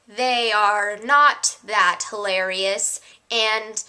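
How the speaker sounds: very slow, measured delivery; mock discouragement. Vlogger pretending to warn viewers off videos, slow mock discouragement. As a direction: spell it out slowly, word by word. Deadpan reverse plug for YouTuber.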